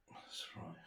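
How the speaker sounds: breathy, barely voiced agreement to himself; whispered aside. Real, not acted. Recalling his own thoughts, a whispered aside to himself. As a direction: say it under your breath.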